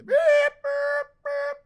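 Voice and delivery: high, loud bleeped-out noise; mock censor bleep. Host censoring his own swear with a squeaky beep.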